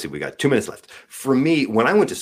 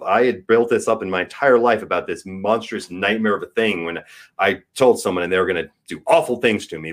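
usual speaking voice

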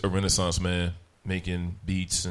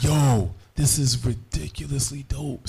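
higher and louder, slow, amazed; hyped reaction; 7 semitones higher, 5 dB louder, slower than usual. Retold or imitated. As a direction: gush like you just heard a fire beat. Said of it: "And I was like".

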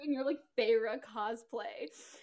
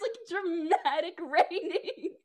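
usual speaking voice

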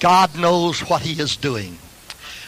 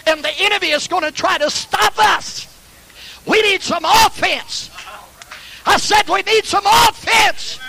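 rally the church, climbing higher and higher; preaching crescendo; 9 semitones higher than usual. Real, not acted. Preaching builds to a shout.

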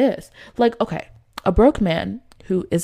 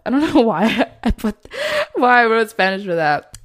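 amused laughing; 4 semitones higher, 4 dB louder than usual. Real, not acted. Laughs at her own written note.

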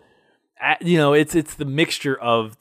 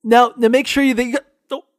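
bad connection; 9 semitones higher, 5 dB louder than usual. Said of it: "pretend like phone's breaking up".

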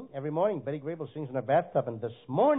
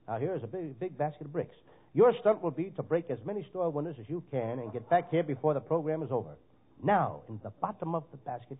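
ordinary delivery

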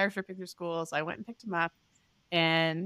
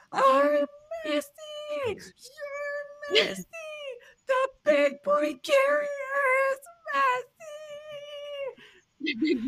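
put-on child screaming and crying; slower than usual. Upset son screaming about his carrier, very high crying. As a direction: scream it like a sobbing toddler. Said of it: "he starts screaming".

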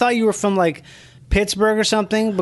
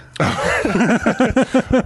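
laugh; 5 semitones higher than usual. Real, not acted. Laughing at own joke.

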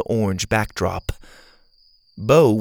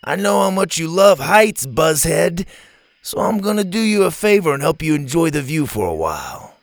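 higher-pitched bully's taunt; mock-kind taunt; 6 semitones higher than usual. A narrator's reading. Ash strands Bo up the tree, taunting. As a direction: gloat with fake helpfulness.

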